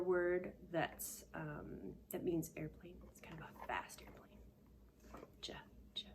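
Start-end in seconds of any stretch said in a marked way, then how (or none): 3.1-6.1 whisper. Pretend. Whispered hint to kids.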